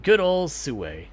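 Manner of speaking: normal talking voice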